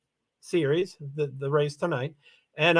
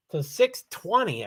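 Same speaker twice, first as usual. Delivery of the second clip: ordinary talk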